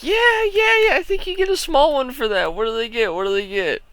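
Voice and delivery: high, loud mocking parent voice; mock pushy parent. Parent pressing the game worker for a prize, high mock eagerness. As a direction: ham up an overeager parent.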